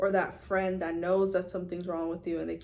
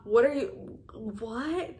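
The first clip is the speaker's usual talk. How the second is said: baffled; 3 semitones higher, 3 dB louder, slower than usual. Retold or imitated. Baffled retort to the advice.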